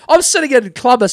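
ordinary delivery

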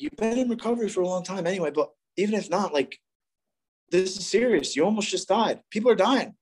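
imitating a sponsor's urgent talking-to; urgent warning. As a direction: press the point like an earnest sponsor. Sponsor urging him in retold quarrel.

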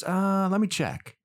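a lightly voiced clerk taking his time; shop clerk voice. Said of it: "And he goes".

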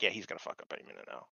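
quiet, fast, bored mutter; jaded ex-wife muttering about her husband. Retold or imitated. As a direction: mutter it like you've given up on him. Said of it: "jaded ex-wife thing down perfect".

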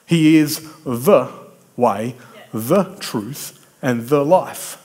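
slow, weighty THE before each noun; stress on THE. Shown as a demonstration. Preacher naming Jesus as the one truth, stressing THE. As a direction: set "the" apart each time. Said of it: "when I read the scripture differently".

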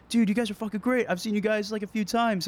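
high-pitched gushing fan voice; excited fan. Retold or imitated. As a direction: gush like a starstruck fan.

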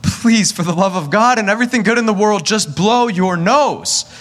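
desperate pleading. Retold or imitated. Sleepless father begging his son to blow his nose, desperate plea. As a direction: beg him for the love of God, stretched out.

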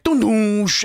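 loud mock soap-opera "dun dun"; dramatic zoom-in sting. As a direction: imitate a melodramatic music sting with a whoosh. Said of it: "the zoom-ins are horrible".

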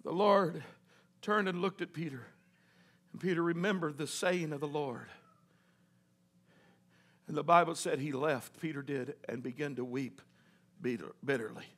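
very soft, low, solemn storytelling; hushed narration. A narrator's reading. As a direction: drop to a hush, with long pauses.

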